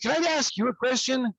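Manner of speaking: lightly hammed-up sweet setup; mock polite challenge. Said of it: "I said".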